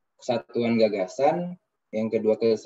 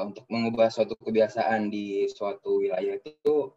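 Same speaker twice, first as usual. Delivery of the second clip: normal talking voice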